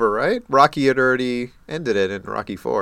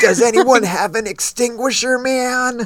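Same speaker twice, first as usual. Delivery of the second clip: mock bro voice; 11 semitones higher, slower than usual. Continuing the bro impression.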